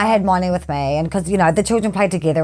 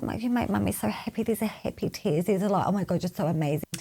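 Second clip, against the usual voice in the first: much softer, hushed mummy voice; tender reassurance; 11 dB quieter than usual. Retold or imitated. Mum explaining happy tears to child, tenderly. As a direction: softly imitate comforting a little one.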